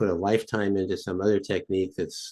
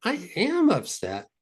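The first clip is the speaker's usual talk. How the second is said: exaggerated back-and-forth; 12 semitones higher than usual. Shown as a demonstration. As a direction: argue with yourself in a high mock voice. High-voiced acted exercise continues.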